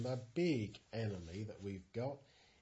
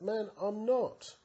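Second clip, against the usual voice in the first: higher, louder, slow denial; Peter's nervous denial; 8 semitones higher, 4 dB louder than usual. Acted. Peter denying a second accuser, a higher, louder, slow protest. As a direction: push the accusation away.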